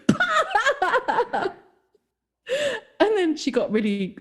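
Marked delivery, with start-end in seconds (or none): 0.1-1.5 mocking laugh burst. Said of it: "I just went".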